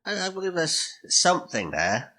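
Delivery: ordinary talk, nothing marked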